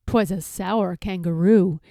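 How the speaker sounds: usual speaking voice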